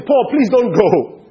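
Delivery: high, drawn-out loud plea; mock pleading. Friends beg Paul not to go, mock pleading. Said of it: "begged him".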